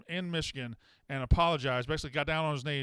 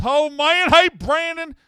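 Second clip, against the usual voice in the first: shrill, much higher and louder mock of the caller's fake accent; 11 semitones higher, 14 dB louder, slower than usual. Host mocks the prank caller's fake accent, high and loud. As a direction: throw the caller's silly voice right back at him, loud. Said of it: "Stop the fake accent".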